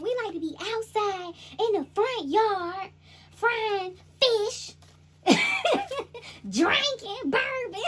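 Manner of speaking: hammed-up country relative; country ancestor. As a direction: brag like kinfolk at a cookout.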